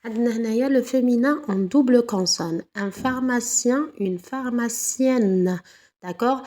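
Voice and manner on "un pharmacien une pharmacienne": slow pair with the "-ienne" ending drawn out; teacher showing the doubled consonant; slow teaching demo. Shown as a demonstration. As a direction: stretch "pharmacienne". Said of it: "le yen devient yen".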